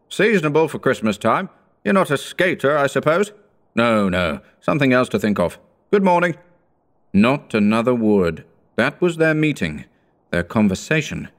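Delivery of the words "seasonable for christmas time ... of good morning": high, brisk clipped toff; a prim rich man; high businessman voice. A narrator's reading. Second businessman replies.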